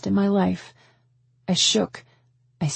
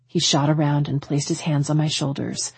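ordinary delivery

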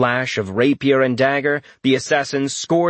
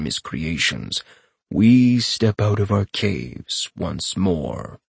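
rasping voice; 6 semitones lower than usual. A narrator's reading. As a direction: intone it gravely, sinking deeper as it goes. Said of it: "the rasping voice said".

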